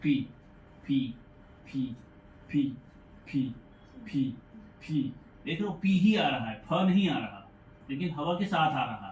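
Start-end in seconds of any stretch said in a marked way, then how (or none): 0.0-5.1 पी with tongue out and a strong puff of air; teacher drilling पी with air; aspirated p drill. Shown as a demonstration. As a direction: repeat पी, breathing air out each time. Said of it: "लेकिन जीभ बाहर निकाल के".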